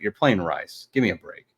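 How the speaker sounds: normal talking voice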